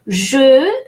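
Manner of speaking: Je with a rounded "euh" vowel; unaccented French E. Shown as a demonstration. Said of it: "E without an accent is pronounced E".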